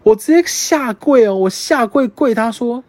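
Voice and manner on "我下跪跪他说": ordinary talk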